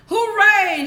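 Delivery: high, loud cheer; joyful shout. Acted. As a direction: cheer like overjoyed kids. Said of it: "shouted the boys, jumping with joy".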